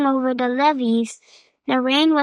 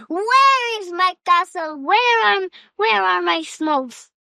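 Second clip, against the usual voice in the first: higher, hammed-up ogre yell; 5 semitones higher than usual. A narrator's reading. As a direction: bellow for the castle and the smokes. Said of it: "The ogre yelled louder and louder".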